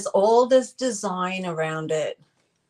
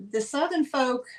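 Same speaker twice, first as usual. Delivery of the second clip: usual speaking voice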